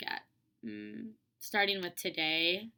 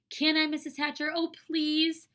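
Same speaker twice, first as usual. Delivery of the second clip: put-on high-pitched pleading little girl; eager, pleading kid voice; begging; 8 semitones higher, 4 dB louder than usual. A narrator's reading. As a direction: beg a grown-up to say yes, voice way up. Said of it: "begged some more".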